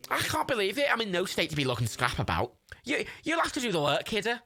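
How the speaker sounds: high put-on Scouse complaint; Scouse Jimmy. Injured scrapper handing the work over, complaining Scouse. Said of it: "I said I'd do the Scouse accent".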